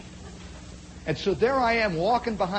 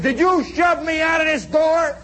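loud, high challenge; acted confrontation; 7 semitones higher, 5 dB louder than usual. Private confronting the man who shoved him, loud angry challenge. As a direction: demand it heatedly, retelling a fight.

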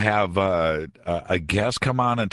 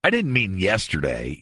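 backtracking doomsday prophet; 2 semitones higher, slower than usual. Acted. Radio host mocking a failed prophecy maker's excuses, backtracking. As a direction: stall and hedge like a caught-out prophet. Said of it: "says something like this".

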